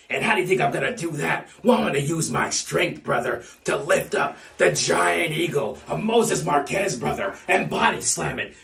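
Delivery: ordinary talk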